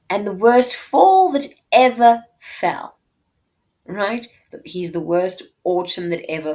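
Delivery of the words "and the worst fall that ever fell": dramatic reading. Acted. Reading Portia's line.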